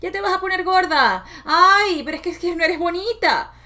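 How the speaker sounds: put-on high, loud, scolding old-woman voice; strict grandmother's voice. Her strict grandmother scolding her about weight, high and nagging. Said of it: "escuchaba perfectamente su voz diciendo".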